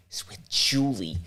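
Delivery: slow, secretive whisper; whispered name. Retold or imitated. Whispering to his boss who the date is. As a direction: let the name slip out in a whisper.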